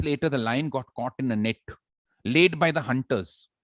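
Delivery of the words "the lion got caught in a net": ordinary talk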